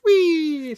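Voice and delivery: a high, delighted "whee" while flying; gleeful whee. Retold or imitated. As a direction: squeal it like a kid on a ride. Flipped over the roof.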